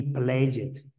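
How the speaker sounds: slow "play it" with -ed as a separate syllable; learner's mistake, "play it" with an extra vowel; mispronounced -ed. Shown as a demonstration. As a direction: break "play it" into two beats. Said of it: "nunca se va a decir".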